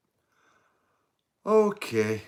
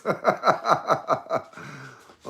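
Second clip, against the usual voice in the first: burst of laughter; 7 semitones higher, 6 dB louder than usual. Real, not acted. Laughing at a comment.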